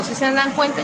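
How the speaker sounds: usual speaking voice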